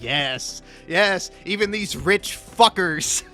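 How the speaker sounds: normal talking voice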